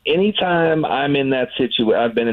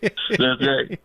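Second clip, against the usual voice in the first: laughing; 3 semitones higher than usual. Pretend. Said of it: "And I can even cry if I want to".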